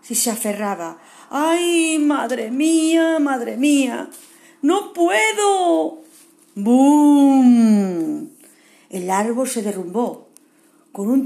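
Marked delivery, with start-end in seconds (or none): 1.3-6.0 high, loud, drawn-out cries of fear; panicked wail. Acted. Kevin clings to the falling tree, wailing in panic. 6.5-8.3 booming loud bum; crash. Acted. The tree crashing down, booming.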